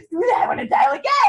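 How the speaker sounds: hooked on the thrill of booking; excited quote. Retold or imitated. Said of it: "you're so excited".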